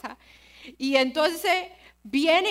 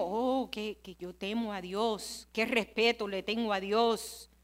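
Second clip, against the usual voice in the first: softer, mocking pious claim of fearing God; mock piety; 6 semitones lower, 8 dB quieter than usual. Preacher mocking believers who claim to fear God, softly. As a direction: imitate a hollow churchgoer's boast. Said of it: "cuántas veces nosotros decimos".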